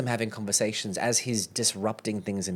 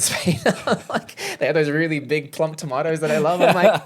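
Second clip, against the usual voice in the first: talking through laughter, higher and louder; laughing; 7 semitones higher, 8 dB louder than usual. Real, not acted. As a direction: laugh while you gush about the food. Gushing about Spanish tomatoes.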